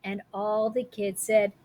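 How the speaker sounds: ordinary delivery, nothing marked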